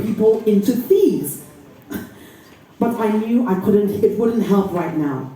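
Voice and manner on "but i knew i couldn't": plain reading voice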